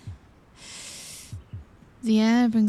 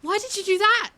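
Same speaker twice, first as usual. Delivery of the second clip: high, shocked question; startled; 11 semitones higher, faster than usual. Acted. Princess startled by the surprise attack, shocked and high. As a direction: caught off guard and rattled.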